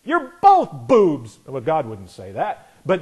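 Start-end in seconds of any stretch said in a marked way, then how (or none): 0.0-1.4 high, loud, amused divine pronouncement; voice of God. Retold or imitated. God laughing down at left and right, high loud mock decree. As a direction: play God mocking both squabbling sides, high and loud. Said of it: "God sits up there and laughs at them and says".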